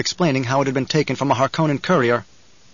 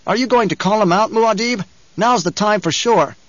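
higher-pitched, insistent goading; urgent challenge; 7 semitones higher, 3 dB louder than usual. A narrator's reading. A companion goading Muad'Dib to call out Stilgar, urgent. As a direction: press him hard to act.

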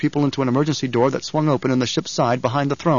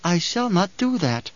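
put-on calm child Alia; 5 semitones higher, slower than usual. A narrator's reading. Alia voiced, slow.

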